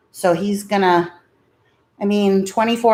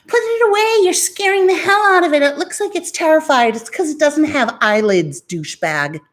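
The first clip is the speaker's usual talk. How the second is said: mock commenter; 9 semitones higher than usual. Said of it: "be like".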